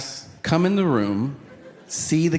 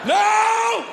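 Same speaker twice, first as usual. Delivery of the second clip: cursing at the top of your lungs over lost car keys; frustrated yelling; much slower than usual. Retold or imitated. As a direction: scream it out at your own life. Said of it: "I'm just yelling at my life".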